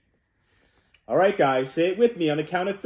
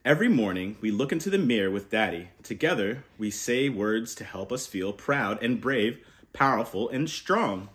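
gentle, lower storybook reading voice; reading to children; 6 semitones lower, 4 dB quieter than usual. Acted. Uncle reading a book to kids, softly. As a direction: read it like a bedtime picture book.

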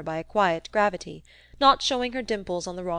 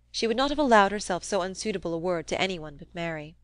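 ordinary delivery

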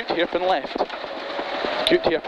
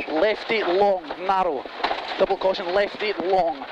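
ordinary delivery

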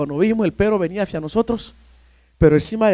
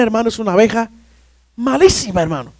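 ordinary delivery

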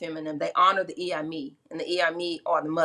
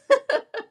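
quick high burst of laughter; short high laugh; 4 dB louder than usual. Real, not acted. Storyteller laughing mid-quote, a quick high laugh. Laughing at her own retelling.

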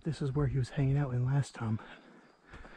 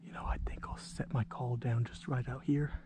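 whispering; 4 dB quieter than usual. Real, not acted. Whispers near game.

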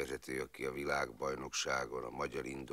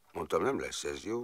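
normal talking voice

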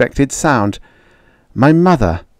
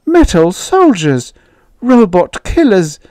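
frightened; 11 semitones higher, 4 dB louder than usual. Acted. Mother recoils at the idea.

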